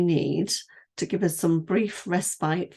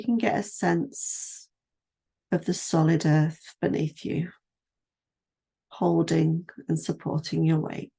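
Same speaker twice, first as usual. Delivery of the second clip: guided meditation; 2 semitones higher than usual. Acted. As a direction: ease the listener down through the body, unhurried. Leads a standing body scan.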